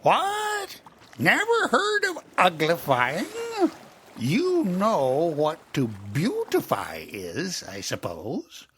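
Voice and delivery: high, loud disbelieving voice; astonished Gryphon. Acted. Gryphon amazed at Alice's ignorance, loud and high. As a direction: gasp in disbelief. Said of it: "lifted up both its paws in surprise".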